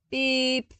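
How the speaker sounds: sharp high electronic beep; insistent datapad beep; imitated beep. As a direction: chirp it like a datapad alarm. Datapad alarm repeats.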